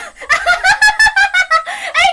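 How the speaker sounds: shrill-pitched shouted cackle; mocking laugh at her sister; loud high laugh. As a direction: burst out laughing loudly. Girl's mocking laugh before leaving.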